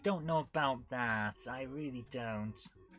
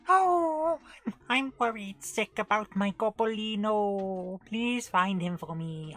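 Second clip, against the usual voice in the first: high, loud mock Welsh witch, slowly pleading; bad Welsh witch; 7 semitones higher, 7 dB louder, slower than usual. Witch begs Dizzy to find her cat, hammed-up Welsh accent. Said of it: "The witch is Welsh in this version".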